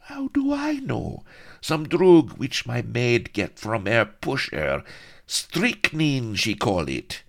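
put-on high flustered girlish voice; flustered damsel. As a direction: toss it off high and giddy. Heroine answers in panic.

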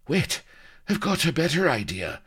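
high, urgent colonel; urgent hiss. A narrator's reading. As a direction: hiss the bright idea, pitched well up. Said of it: "hissed the colonel".

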